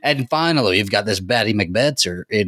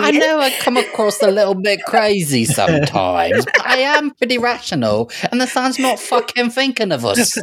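pitched way up, laughing through a mock rant; Drusilla-style rant; 13 semitones higher than usual. Pitched-up mock Drusilla rant to the co-hosts, laughing. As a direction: play the unhinged vampire insisting she's rational.

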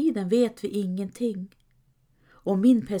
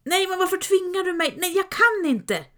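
high, pleading refusal; frightened whining sidekick; whiny protest; 10 semitones higher than usual. Acted. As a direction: beg not to look, voice up high. Sancho refuses to look.

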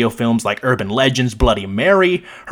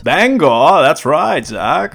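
hammed-up Maine accent, pitched up at first; 3 semitones higher, 4 dB louder, much slower than usual. Co-host naming the town to Zach, mock Maine accent. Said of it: "the Maine accent touching our hearts".

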